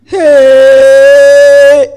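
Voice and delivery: long loud mourner's wail. Shown as a demonstration. Demonstrating to the congregation how hired mourners wail, wailing. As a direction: let out a drawn-out mock wail. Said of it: "How do they wail?".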